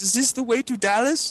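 lightly voiced confused driver, pitched high; a clueless stranger asking directions, high; lost driver. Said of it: "He goes".

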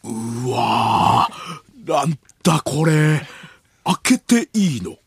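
slow, drawn-out mock amazement; 伊集院光. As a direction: marvel slowly as if seeing something baffling. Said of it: "伊集院光さんが". (わざとらしく驚く伊集院のものまね)

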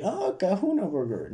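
lightly playing a Pulp Fiction character; Pulp Fiction quote. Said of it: "agarré una de Pulp Fiction". (imitación ligera de personaje de película, más aguda)

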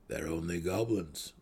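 low, soft, grim mutter. Acted. Gnome brushing off the goblin threat, grim mutter. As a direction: mutter it grimly under your breath. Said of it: "he muttered grimly".

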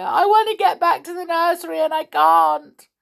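high, loud tearful wail; sobbing. A narrator's reading. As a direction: plead through sobs. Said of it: "sobbed Amelia".

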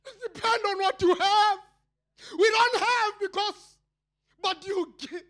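high shouted preaching; preaching at full force. Real, not acted. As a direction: drive the point home.

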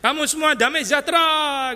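high-pitched parody of a herald; mock grand announcement. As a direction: call a blessing out over a whole crowd. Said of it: "datang terus mengumumkan".

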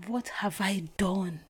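slow, hushed whisper of dread; despairing whisper. Retold or imitated. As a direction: whisper it slowly, as if the floor dropped away. Despair after finding the email.

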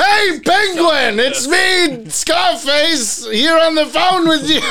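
very high, slow, loud shout; high shouting puppet voice. Retold or imitated. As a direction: yell it like a squeaky dummy. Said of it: "he'd just shout".

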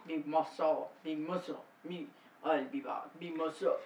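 deep, soft strongman impression; Arnold Schwarzenegger. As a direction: mumble low in an accented action-hero voice. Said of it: "get a deep voice and go".

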